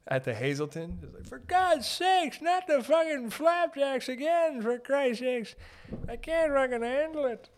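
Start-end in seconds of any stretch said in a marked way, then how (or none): 1.3-7.5 very high, drawn-out put-on complaint; hammed-up Yukon grumbler at breakfast; exasperated Yukon resident. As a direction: whine it slowly, way up high. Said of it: "they're from the Yukon".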